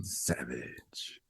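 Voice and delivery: very low, soft, drawn out savage; low dramatic whisper. Pretend. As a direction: breathe it ominously.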